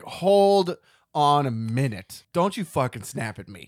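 mock indignant, slow emphatic opening; indignant warning. Host reacting to the wife snapping at him, mock indignant. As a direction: stretch the first words out loudly, then warn. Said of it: "I was like".

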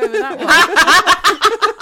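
laughing through the words; giggling while answering. Real, not acted. Laughing at own joke.

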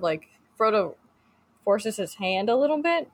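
usual speaking voice

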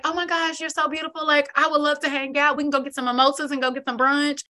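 high, gushing fake-friendly voice; mock flattering guy. Host imitating TikTok guy flattering women, high gushing. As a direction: gush compliments like a new best friend. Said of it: "he's like".

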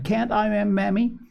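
put-on high eager little girl voice; a little girl begging her mother; little girl crying out. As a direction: plead like a small child wanting her best shoes. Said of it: "she cried".

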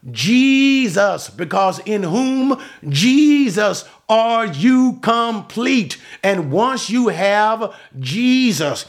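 high-pitched, emphatic sermon climax; full-force preaching. Real, not acted. Preacher urging believers to stay steadfast, impassioned preaching. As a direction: drive each phrase home, stretching key words.

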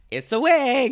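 high, loud mock blurt of the big reveal. Retelling the wig reveal to date.

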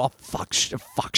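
hushed, unvoiced swearing; whispered curse. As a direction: whisper the curses under your breath. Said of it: "You hear the cameraman go".

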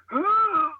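high-pitched imitated retching gasp; dry heaving. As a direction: act out someone about to throw up. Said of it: "dry heaving".

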